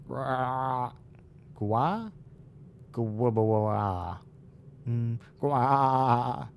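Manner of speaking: bleating goat sounds. Acted. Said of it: "Goat voice".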